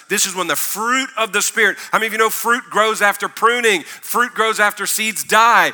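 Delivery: loud, rising sermon build; preaching. Acted. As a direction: preach it with full force. Preaching at full force.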